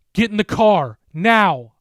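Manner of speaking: high, loud urgent order; urgent shout. A narrator's reading. Mark orders Jen into the car, urgent shout. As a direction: bark the command.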